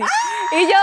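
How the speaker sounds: thrilled high yell; excited shout. Real, not acted. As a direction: shriek with delight. Joyful news, both exclaim.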